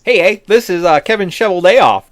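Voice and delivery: big, loud mock-Canadian phone greeting; Canadian accent. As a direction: put on your best cheery Canadian accent. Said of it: "doing my best Canadian accent".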